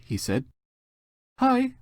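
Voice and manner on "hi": much higher, mock-flirty hi; greeting the crush in a hammed-up flirty voice. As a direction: coo it at your crush. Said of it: "I replied flirtily".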